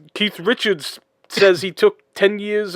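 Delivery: half-hearted British roadie impression; bad British accent. Friend mocks the roadie, in a bad British accent. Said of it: "that was a horrible British accent".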